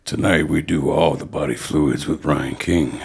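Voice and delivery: low, slow announcer read; deep dramatic voiceover. Shown as a demonstration. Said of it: "I'll do voiceover for your OnlyFans".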